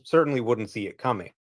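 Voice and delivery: ordinary delivery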